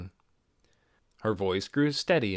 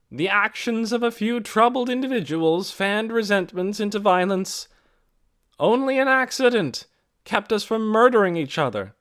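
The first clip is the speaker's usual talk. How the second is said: raised, carrying speech to a crowd; Mary's public address; 12 semitones higher, 7 dB louder than usual. A narrator's reading. Mary speaking out to the village meeting, firm and carrying. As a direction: address the whole meeting firmly.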